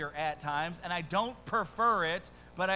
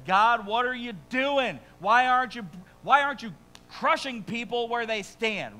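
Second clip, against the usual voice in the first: frustrated outcry; 5 semitones higher, 6 dB louder than usual. Retold or imitated. Prophet complaining to God.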